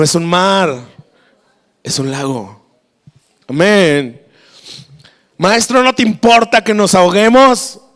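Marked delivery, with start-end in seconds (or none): 5.4-7.8 raised, higher-pitched plea; shouted plea. Acted. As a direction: cry out to the teacher.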